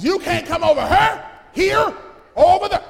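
rising to a shout on there. Shown as a demonstration. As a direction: throw it across the gulf. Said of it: "repeated, louder".

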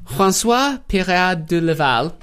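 slow, rolled-out French pronunciation; French name with a flourish; French accent. Acted. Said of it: "the very French name".

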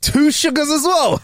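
high, slow disbelieving question; high, slow incredulous query; surprised. Real, not acted. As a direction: pitch it up in shock. Shocked reaction to two sugars.

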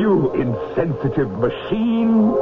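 losing control in high panic; panicked outburst. Acted. Terrified professor shrieking at his butler in panic.

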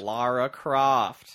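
slow, loud mock drawl of "Lara Croft"; bad American accent. Co-host echoing Craig's awful accent, slow and loud. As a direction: echo the line in a clumsy American voice. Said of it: "sounded like Harrison Ford making fun of Harrison Ford".

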